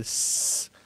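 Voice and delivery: "s" as a long voiceless hiss, no E; pure S sound. Shown as a demonstration. Teacher isolating the sound for learners, long hissed "s". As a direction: hiss the "s" on its own. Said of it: "Solamente ese sonido".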